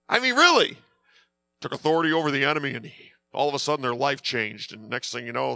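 ordinary delivery

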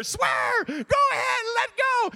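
high, loud yell of encouragement; goading shout. Retold or imitated. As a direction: holler across the job site, egging him on. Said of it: "I'm hearing him over there".

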